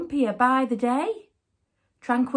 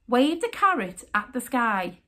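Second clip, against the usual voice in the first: ordinary delivery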